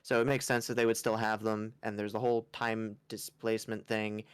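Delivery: normal talking voice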